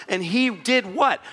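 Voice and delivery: ordinary talk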